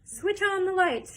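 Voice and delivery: loud, high, slow command; shouted. Acted. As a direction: call out for the lights. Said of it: "shouted Mister Wonka".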